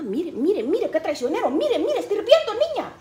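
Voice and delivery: mother urgently pointing at boiling pot; excited warning. Retold or imitated. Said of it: "como dijo mi mamá".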